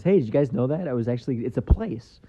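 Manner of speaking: ordinary talk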